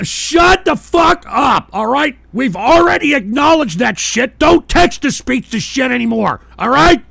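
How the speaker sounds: real angry outburst at a chat message; furious shout. Real, not acted. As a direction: yell it right at the troll, loud and high. Yelling at troll donor.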